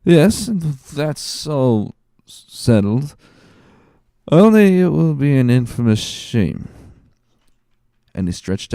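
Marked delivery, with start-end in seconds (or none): 0.0-6.7 put-on high, slow, slurring drunk drawl; drunken Caderousse. Drunk Caderousse echoing Danglars, slow and slurred. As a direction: drag the words out like a tipsy man. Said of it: "by a last effort of intellect".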